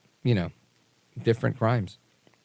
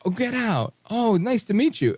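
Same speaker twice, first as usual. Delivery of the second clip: mock surprised guard; 12 semitones higher, 3 dB louder than usual. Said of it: "he was like".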